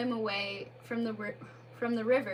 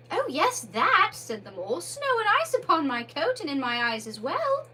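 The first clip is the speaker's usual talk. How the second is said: irritable; 6 semitones higher, 6 dB louder than usual. Acted. Mole voice.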